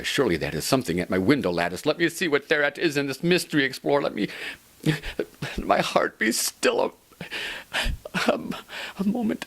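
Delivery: high, loud, fast and frantic; excited. Acted. Narrator rushing to the window, excited. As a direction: heart racing, rush the words.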